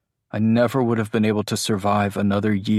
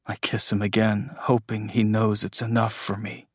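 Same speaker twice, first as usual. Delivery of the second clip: plain reading voice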